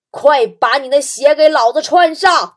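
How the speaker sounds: loud, slow, menacing yell; ferocious full-volume command; fierce barked order. Acted. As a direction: bellow it word by word like a furious bully. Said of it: "恶狠狠地瞪了媳妇儿一眼".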